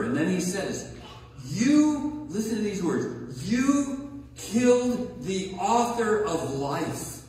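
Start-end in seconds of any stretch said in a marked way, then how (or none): 1.4-2.3 a sharp high YOU flung at the crowd; single it out, point the finger; emphatic you. Acted. Preacher to the crowd, a sharp accusing YOU. 3.5-7.2 "you killed the author of life" spelled out slowly, each word weighted; slow, heavy emphasis. Acted. Preacher confronting listeners, slow and accusing. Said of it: "He's not mixing any words here".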